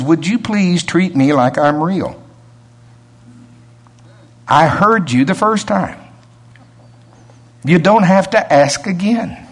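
a hint of a patient, weary God. God reminds the preacher he already heard, lightly voiced and patient.

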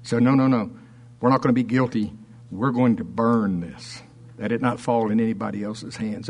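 ordinary delivery

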